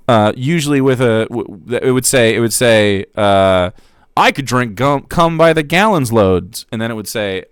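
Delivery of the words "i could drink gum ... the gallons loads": louder jeering chat voice; a lightly voiced troll, louder; mock troll chatter. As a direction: ham up a crude troll. Reading a troll chat message.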